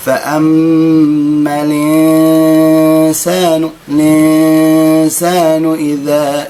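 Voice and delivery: slow, melodic tajwid chanting; Quran recitation. Shown as a demonstration. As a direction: chant the verse slowly. Recites the verse. (ترتيل)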